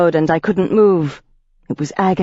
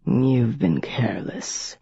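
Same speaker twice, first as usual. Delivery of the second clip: lower, slow and flat, softer; flat vampire voice; 3 semitones lower, 6 dB quieter, slower than usual. A narrator's reading. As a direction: coldly dismiss her. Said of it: "he said flatly".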